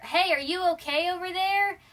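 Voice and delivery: mild acted-out call; calling from afar. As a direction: check on someone at a distance. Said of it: "you hear in the distance".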